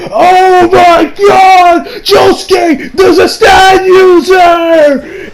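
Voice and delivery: high, loud, long-stretched alarm cry; hammed-up panicked shout. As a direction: wail it like an old man losing it. Joseph acted out, panicking at discovery.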